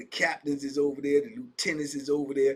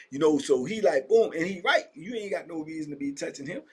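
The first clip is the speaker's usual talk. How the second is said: ordinary talk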